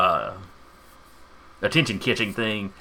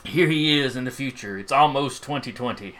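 ordinary delivery

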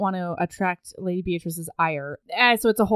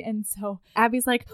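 ordinary talk, nothing marked

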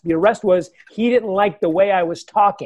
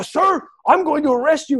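higher-pitched, mock-indignant officer; irate cop; 8 semitones higher than usual. Cop threatening a driver with arrest, irate imitation. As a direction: imitate an officer losing patience. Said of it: "he's getting irate".

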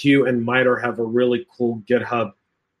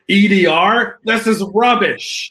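higher, louder, slow mock Ramsay scolding; mock Gordon Ramsay rant; 7 semitones higher, 4 dB louder, slower than usual. Host imitating a chef trashing a security tool, hammed-up and loud. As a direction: play a celebrity chef sneering at a bad product. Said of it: "Imagine Gordon Ramsay walking in".

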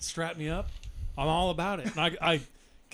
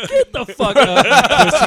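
mock yell; 7 semitones higher, 14 dB louder than usual. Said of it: "I was like".

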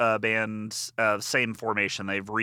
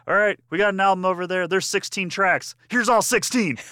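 high, loud punk frontman intro; imitated stage announcement; 9 semitones higher, 6 dB louder than usual. Host playing the frontman introducing an album, hyped shout. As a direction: call it out to the crowd, building to the last words.

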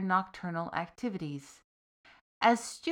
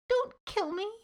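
much higher, squeaked in fright; frightened squeak; 13 semitones higher than usual. Acted. Pinned soldier pleads with the demon, squeaking in fear. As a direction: squeak it out, terrified. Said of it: "the soldier squeaked".

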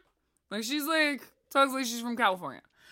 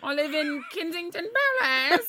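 put-on posh British accent; 5 semitones higher than usual. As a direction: play it grandly posh and British.

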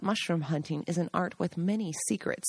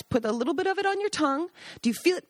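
coaxing guide; 8 semitones higher, faster than usual. Retold or imitated. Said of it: "tell the guests".

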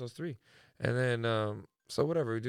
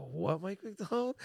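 surprised; 8 semitones higher, slower than usual. Retold or imitated. Narrator's retold disbelief.

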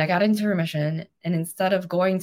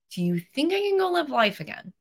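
tentative question; 9 semitones higher than usual. Retold or imitated. Timidly asking her doctor, retold.

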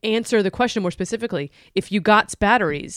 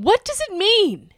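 mock panic; 12 semitones higher than usual.

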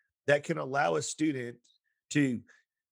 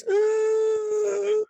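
imitated little wail, far higher; kid's cry; 7 dB louder than usual. Frightened kindergartner startled into crying, mock wail. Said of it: "I cried".

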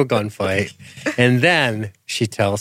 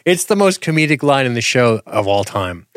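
ordinary talk, nothing marked

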